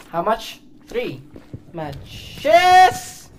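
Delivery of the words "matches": excited exclamation. Real, not acted. Delighted count of found matches.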